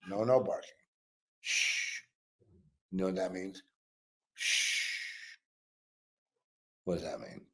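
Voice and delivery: soft shushing of a dog; hushing a pet. Real, not acted. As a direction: hush the dog gently.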